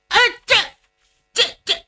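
high, stumbling 这这; flustered stammer. Acted. Cheng stammering when the strategist scolds him. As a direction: caught out, can't find words.

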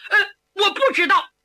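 fast, choked answer through tears; crying. Acted. Son cornered by his beloved, answering tearfully. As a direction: blurt it out, near sobbing. Son pressed about father's crimes.

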